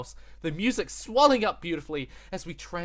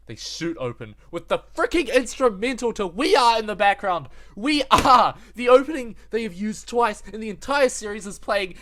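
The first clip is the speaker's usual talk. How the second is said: louder and higher, bursting with excitement; hyped up; 8 semitones higher, 11 dB louder than usual. Real, not acted. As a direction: ride the rush of the opening song. Hype as the opening song kicks in.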